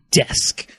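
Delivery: usual speaking voice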